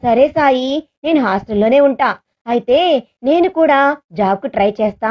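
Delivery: ordinary delivery, nothing marked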